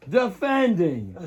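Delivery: drawn-out fight announcer call; ring announcer voice. Pretend. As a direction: stretch each word like a ring announcer. Mock boxing ring introduction.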